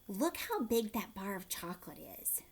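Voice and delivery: ordinary delivery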